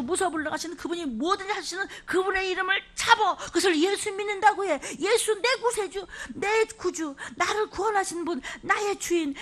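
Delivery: high, rising litany of names for Jesus; fervent preaching. Real, not acted. Preacher rallying the sick to claim Jesus's name. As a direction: proclaim each title with mounting fervour.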